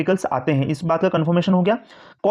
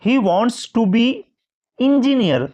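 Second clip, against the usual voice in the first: slow example reading; 4 semitones higher, much slower than usual. Shown as a demonstration. Teacher reading the example sentence slowly. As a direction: read the sentence slowly, lift the last word. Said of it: "तो sound देखो ई का आ रहा है".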